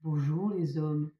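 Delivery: failed attempt at a deep voice; Roger voice. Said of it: "J'arrive pas à faire la voix grave".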